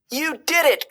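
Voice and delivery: very high, thrilled shout; joyful cry. A narrator's reading. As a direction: cheer him on, sky-high pitch. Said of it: "cried Timothy".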